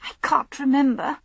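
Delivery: plain reading voice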